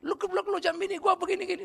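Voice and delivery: high-pitched imitation of an angry friend; comic, high indignant complaint; mock quarrel. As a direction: scold a friend, retold for laughs. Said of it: "dia kelahi sama saya".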